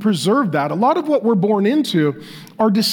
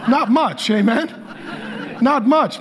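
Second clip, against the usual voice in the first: laughing; 4 semitones higher than usual. Real, not acted. Joking about Irish contributions.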